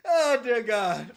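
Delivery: slow, laughing groan of disbelief. Real, not acted. Co-host cracked up by the impersonation, laughing exclamation. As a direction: drag it out through real laughter.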